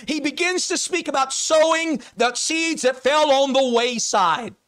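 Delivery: high, emphatic pulpit voice; full-force preaching. Real, not acted. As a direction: drive the point home to the congregation.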